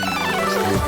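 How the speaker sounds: ordinary talk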